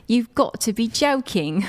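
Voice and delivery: put-on slow, incredulous British accent; unimpressed British "You've got to be joking". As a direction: drawl it out like a shocked Brit. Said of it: "British people be like".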